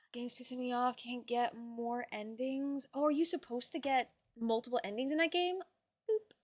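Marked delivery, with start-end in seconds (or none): none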